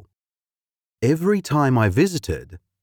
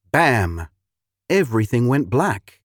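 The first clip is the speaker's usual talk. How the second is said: usual speaking voice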